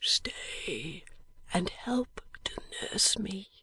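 barely voiced, dying breath; a dying man's faint whisper. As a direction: whisper it weakly from a sickbed. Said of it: "said Eugene quietly".

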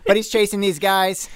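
ordinary delivery

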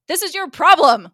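acted angry outburst, punched loud; mad. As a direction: lash out as the furious dean, hammed up. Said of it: "He's mad".

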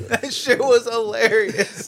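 high, drawn-out amusement; amused, laughing delight. Real, not acted. Friend relishes the old quarrel, high amused drawl. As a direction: stretch it out, still cracked up.

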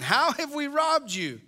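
light sarcastic whine. Retold or imitated. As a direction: ask it with feigned innocence. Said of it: "there's sarcasm again".

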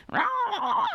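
high-pitched "rah" outburst; mock monster cry. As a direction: imitate someone suddenly turning vicious, way up high. Imitates the neighbor suddenly turning.

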